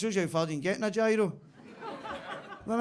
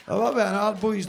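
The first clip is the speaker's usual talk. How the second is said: normal talking voice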